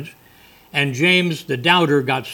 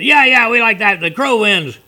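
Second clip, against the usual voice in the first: loud, high-pitched cheering pigs; hammed-up excited pig judges; 8 semitones higher, 5 dB louder than usual. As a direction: cheer the winner like rowdy pigs. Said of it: "the pigs are all excited".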